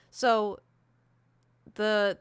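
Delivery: ordinary talk, nothing marked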